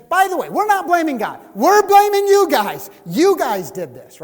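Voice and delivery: sarcastic finger-pointing; mock indignant crowd. Crowd blaming Moses and Aaron, loud mock indignation. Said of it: "It says".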